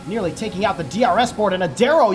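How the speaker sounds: ordinary talk, nothing marked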